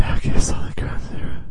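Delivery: fast, loud raspy whisper; failed posh voice. Pretend. Co-host saying the game's name, failed posh voice. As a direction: rush it in a creepy whisper. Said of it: "doing it in your posh voice".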